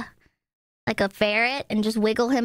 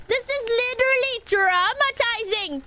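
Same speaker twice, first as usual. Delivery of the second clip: high whiny complaint; dramatic whine; 10 semitones higher, much faster than usual. Real, not acted. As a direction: overplay the outrage, pitched high. Mock complaining to chat.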